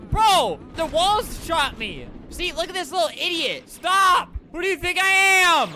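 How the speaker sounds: high, loud gamer outburst; outraged shouting. Real, not acted. Gamer shot by teammates, outraged shouting. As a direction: yell in indignation at the other players.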